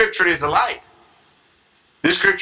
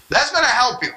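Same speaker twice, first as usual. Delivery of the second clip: ordinary talk, nothing marked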